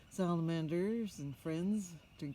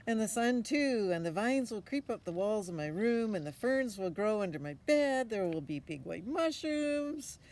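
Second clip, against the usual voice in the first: put-on higher, louder know-it-all boy; confident boy; 4 semitones higher, 7 dB louder than usual. Boy answering his mother, higher and confident. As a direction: toss off the fix like it's obvious.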